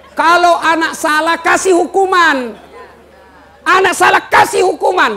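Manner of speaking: high, loud, slow preacher's cry ending in a shout; fiery preaching. Real, not acted. Preacher urging parents to punish wrongdoing, shouting. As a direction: hammer the lesson home to the crowd. Preaching discipline at full force.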